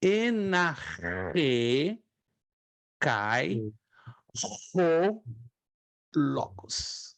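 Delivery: "Enarre cai o logos" again, each word set apart by pauses; teacher's dictation pace; slow repeated model. Shown as a demonstration. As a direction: repeat it slowly for the class. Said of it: "Repito uma vez mais".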